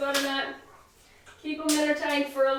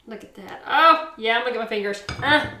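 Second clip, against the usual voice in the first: normal talking voice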